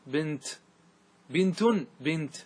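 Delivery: بنت ending in a clear stopped t; pausal t. Shown as a demonstration. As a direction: keep the t in بنت, no h. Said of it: "فتقول في الوقف على بنت". (الوقف على بنت بالتاء الساكنة)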